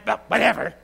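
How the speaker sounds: loud, huffy dismissal; put-on indignant outburst; mock mad. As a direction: imitate someone loudly brushing it off in a huff. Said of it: "all bee in the bonnet and mad".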